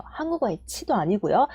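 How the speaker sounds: ordinary talk, nothing marked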